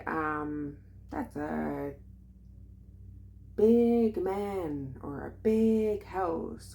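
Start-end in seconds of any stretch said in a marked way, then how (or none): 1.1-5.0 her First Nations accent. Shown as a demonstration. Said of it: "You hear my accent already". 5.3-6.7 "a big house" drawn out slowly and higher in her heritage accent; her First Nations accent. Shown as a demonstration. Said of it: "You hear my accent already".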